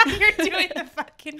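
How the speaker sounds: high loud laughing words. Real, not acted. Bursts out laughing at guest.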